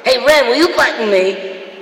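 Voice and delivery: happy, childlike cartoon voice, high and loud; amped-up simpleton delighted by everything; Stimpy. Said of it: "he was always happy and he loved everything".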